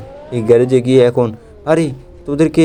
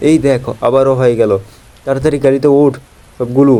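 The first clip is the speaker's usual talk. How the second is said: normal talking voice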